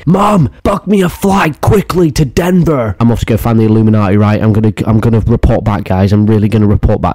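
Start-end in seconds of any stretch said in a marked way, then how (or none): 0.1-2.9 high, drawn-out hammed-up wail to a parent; mock calling for mum. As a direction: call out whiny and urgent, pitched way up.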